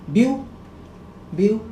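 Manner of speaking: ब्यू cut off from the rest of the word; isolated syllable. Shown as a demonstration. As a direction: say ब्यू by itself as one short syllable. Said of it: "देखिए ब्यू एक बार हुआ".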